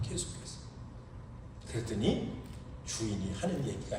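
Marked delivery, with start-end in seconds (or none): 0.0-0.6 whispered echo. Shown as a demonstration.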